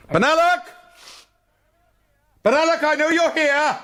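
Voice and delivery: loud, high call into the dark. Acted. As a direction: shout to flush him out. Calling into a dark gallery.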